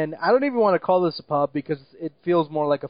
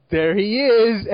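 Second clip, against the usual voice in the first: mock drunken shout; 4 semitones higher, 4 dB louder, slower than usual. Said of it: "Erik Estrada drunkenly goes".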